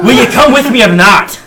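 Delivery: loud high demand; impatient loud. Acted. Dwarf impatiently demands they follow, loud. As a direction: snap at them to hurry.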